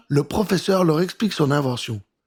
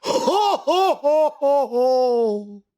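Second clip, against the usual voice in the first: high ho ho ho laugh, falling down step by step; booming laugh; 8 semitones higher, much slower than usual. Acted. Old professor laughing at the children, booming ho ho ho. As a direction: laugh like a jolly old inventor. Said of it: "éclate à nouveau de rire".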